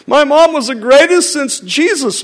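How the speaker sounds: high, hammed-up gushing voice; mock gushing praise. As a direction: parody an over-the-top tribute. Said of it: "too effusive in your praise".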